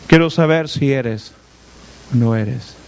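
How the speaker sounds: lightly voiced low old man; a hint of a suspicious blind father; doubting old father. Isaac voiced doubting son.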